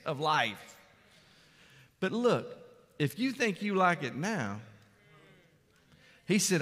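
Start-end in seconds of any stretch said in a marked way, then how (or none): none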